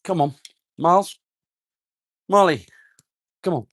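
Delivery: loud call to the dogs. Real, not acted. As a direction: call them over across the yard. Calling the dogs over.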